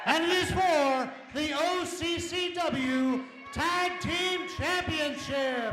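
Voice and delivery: slow, drawn-out arena announcement; boxing ring announcer. Acted. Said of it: "I am the ring announcer tonight".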